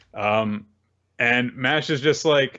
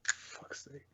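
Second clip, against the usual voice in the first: real whispered curse under the breath; muttered fuck's sake in a whisper; exasperated whisper; 20 dB quieter than usual. Real, not acted. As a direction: breathe it out, fed up.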